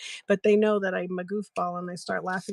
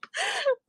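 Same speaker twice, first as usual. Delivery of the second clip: high squealing laugh. Real, not acted. Laughing with a friend.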